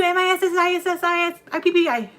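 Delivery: spelling fast. Shown as a demonstration. Boasting to chat that she can spell Mississippi fast. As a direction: rattle off the letters quickly and loudly. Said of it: "I can spell Mississippi fast".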